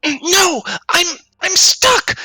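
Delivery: high-pitched cry of alarm. Acted. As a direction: cry out high, trapped and panicking. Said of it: "you hear Baywin cry out".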